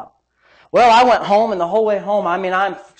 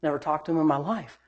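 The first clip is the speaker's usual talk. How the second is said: retold hushed denial; 5 semitones lower, 12 dB quieter, faster than usual. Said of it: "I said".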